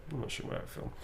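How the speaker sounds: a whispered private aside. Real, not acted. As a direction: breathe it under the breath. Muttered aside to himself.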